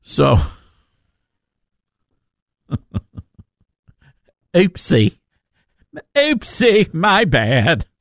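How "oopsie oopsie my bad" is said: campy comic impression, pitch swooping up; Paul Lynde. Host owning up to a name slip, comic impression. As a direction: put on an arch, drawn-out "oopsie". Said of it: "that's my best Paul Lynde impression".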